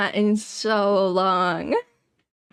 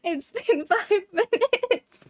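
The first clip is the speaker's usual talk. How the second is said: high laughing words; giggling through it; 8 semitones higher than usual. Real, not acted.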